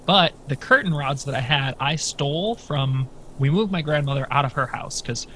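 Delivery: usual speaking voice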